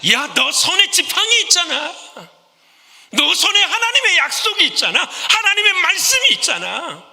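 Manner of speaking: high, urgent calling out; God rebuking Moses. Retold or imitated. God calling to Moses about the staff, high and urgent. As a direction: shake him awake: you have the staff.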